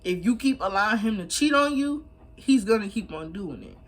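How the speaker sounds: ordinary talk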